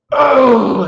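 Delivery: loud, high imitated grunt; big grunting yell. Man ending his argument sentence, imitated grunting yell. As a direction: bellow out a mock grunt. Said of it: "that big grunting".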